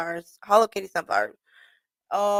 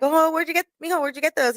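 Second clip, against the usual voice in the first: delighted surprise; 8 semitones higher, 4 dB louder than usual. Retold or imitated. Surprised at cousin's flowers.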